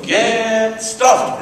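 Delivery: high mock yell of "Get stuffed"; retold yell. Troops yelling at their radios, mock high yell. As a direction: squawk it like a fed-up GI at the radio. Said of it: "yell the G I equivalent of".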